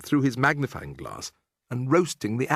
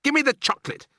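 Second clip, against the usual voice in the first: put-on much higher, louder boy's demand; shouting; 3 dB louder, faster than usual. A narrator's reading. Bruno demanding chocolate from the witch, shouting. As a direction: shout it at her. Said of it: "shouted Bruno".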